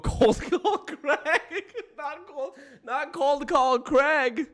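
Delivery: high, slow, words pushed out through laughter; laughing while reading. Real, not acted. Streamer reading chat's nickname aloud, laughing through it. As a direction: stumble over the name while cracking up.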